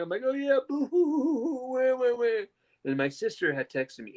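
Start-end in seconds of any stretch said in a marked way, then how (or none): 0.2-2.4 high, hammed-up fake sobbing; boo-hoo like a mocking kid; pitched-up sarcastic fake wailing; mock crying. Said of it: "I was mocking everybody till I was crying".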